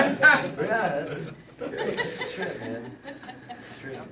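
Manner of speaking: words breaking up in laughter; laughing through words. Real, not acted. Still laughing after the punchline.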